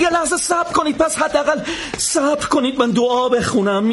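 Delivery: high, urgent cry to wait; high panicked plea. Acted. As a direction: beg them to stop, high. Countdown begins.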